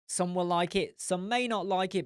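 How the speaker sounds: normal talking voice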